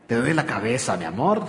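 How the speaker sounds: low, soft teasing line with a chuckle; husband's joking reply. Retold or imitated. Husband teasing wife who has a headache, low laughing. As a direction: murmur it low and playful, half laughing.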